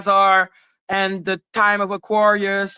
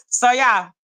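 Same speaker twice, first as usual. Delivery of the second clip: ordinary talk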